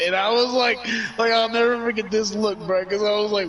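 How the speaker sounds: half-laughing at how wild it was; excited disbelief. Real, not acted. Telling his buddy the night's craziest moment, excited.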